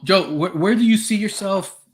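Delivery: usual speaking voice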